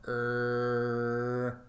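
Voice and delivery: "R" as a long, held rrr sound; voiced consonant demonstration. Shown as a demonstration. Teacher shows sounds that vibrate, held voiced consonant. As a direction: sustain "R" evenly as a bare sound. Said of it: "all of these will vibrate".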